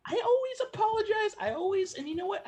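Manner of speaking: high, fast, fed-up outburst; indignant rant. Acted. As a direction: spill the grievance all at once. Roommate ranting in acted spat.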